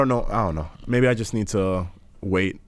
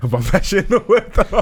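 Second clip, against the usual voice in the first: laughing through the words, higher and louder; 13 semitones higher, 4 dB louder than usual. Real, not acted. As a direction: say it cracking up, pitched up. Laughing while repeating his interrupted line.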